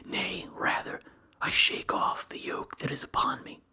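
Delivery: usual speaking voice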